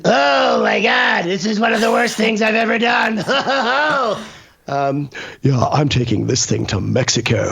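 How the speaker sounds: a hint of a Simpsons voice; cartoon-style exclaim, lightly done; lightly voiced Simpsons character. Asked for a Simpsons voice, a hint of cartoon exclaiming. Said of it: "Do you have any Simpsons voices?".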